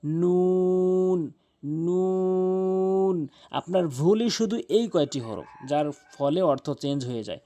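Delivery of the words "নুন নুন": নুন held very long and resonant; nasal nun demo. Shown as a demonstration. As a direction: stretch নুন out with a humming nasal. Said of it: "সহজ নুন".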